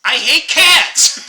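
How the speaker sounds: hammed-up annoyed yell; mock exasperated shout. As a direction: blurt it out, fed up with the cats. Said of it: "and you're like".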